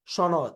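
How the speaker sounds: "সনদ" with palatal sh, shonod; teacher's model of "সনদ" as shonod; correct pronunciation. Shown as a demonstration. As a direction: begin "সনদ" with a clear sh. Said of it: "তালব্য শ দিয়ে হবে".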